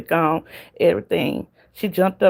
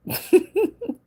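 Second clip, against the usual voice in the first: high giggle. Real, not acted. Laughs at own past fussing.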